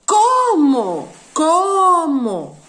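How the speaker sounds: "¿Cómo?" called out loud and high, falling; loud, drawn-out "¿Cómo?" for the class; stressed question word. Shown as a demonstration. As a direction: hit the accented O in "¿Cómo?" hard, twice. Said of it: "ahí se acentúa".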